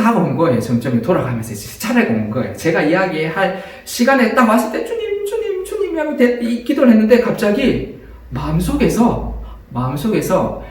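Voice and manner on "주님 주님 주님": calling Lord in a high, soft plea; high pleading prayer. Retold or imitated. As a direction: beg the Lord, high and hushed. Desperate prayer retold. (높고 여린 간절한 기도)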